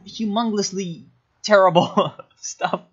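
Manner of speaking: slow words giving way to laughter; breaking into laughter. Real, not acted. Laughing at the player's terrible moves, cracking up. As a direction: crack up partway through the insult.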